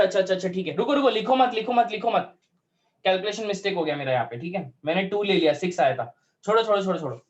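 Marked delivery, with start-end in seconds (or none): 0.8-2.3 higher-pitched, hurried repeated command; real urgent call to halt; urgent interruption. Real, not acted. Teacher hurriedly stops students writing. 6.4-7.2 quick, higher-pitched repeated brush-off; rapid-fire "drop it"; hurried dismissal. Real, not acted. Teacher drops the miscalculated problem.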